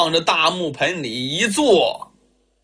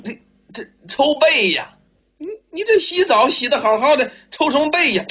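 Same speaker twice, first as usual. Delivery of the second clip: stammering, high and bewildered; a wife who can't believe what he asks; baffled wife; 7 semitones higher than usual. Acted. Wife questions her husband's bath whipping, high and stammering.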